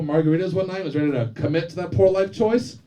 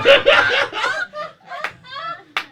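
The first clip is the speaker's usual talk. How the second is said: shrieking real laughter; loud high laugh; 4 dB louder than usual. Real, not acted. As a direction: break up laughing. Comic laughs at own joke.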